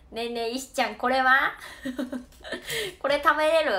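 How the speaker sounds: put-on pestering the farmer like an old friend; casual overfamiliar. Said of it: "タメ口使ってた". (タメ口で馴れ馴れしく質問する)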